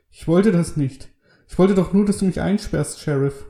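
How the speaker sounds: high-pitched, anguished plea; high, agitated protest trailing off at the end; distraught pleading. A narrator's reading. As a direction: plead desperately from the cell. Killer pleads from his cell.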